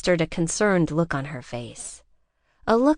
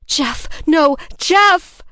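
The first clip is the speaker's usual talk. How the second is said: screaming, much higher and louder; screaming for him at the burning car; anguished screams; 10 semitones higher, 8 dB louder, much slower than usual. A narrator's reading. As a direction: scream his name into the flames.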